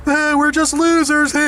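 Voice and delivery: high, slow, dopey put-on voice; mocking loser roommate. Roommate shrugging off the complaint, high dopey mocking voice. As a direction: play the roommate as a lazy shrugging slob. Roommates mocked in retold quarrel.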